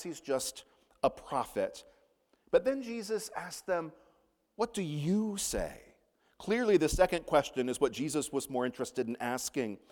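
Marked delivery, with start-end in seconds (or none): 4.5-5.8 slow, with "you" drawn out and emphatic; stress on YOU. Retold or imitated. Jesus puts the question to his disciples, emphatic on "you". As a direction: lean deliberately on the word "you". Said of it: "It's emphatic in the Greek".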